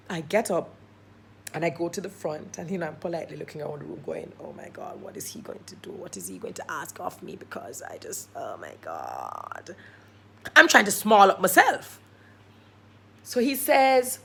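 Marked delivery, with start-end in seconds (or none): 4.4-9.8 very low, whispered panic; dreading inner thought. Retold or imitated. Storyteller fearing the host's question, anxious whisper. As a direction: mutter it privately through a polite front. Dread while looking round politely.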